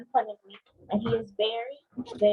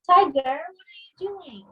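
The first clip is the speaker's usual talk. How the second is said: exasperated call; 7 semitones higher, 5 dB louder than usual. Acted. Tiger blocks the book.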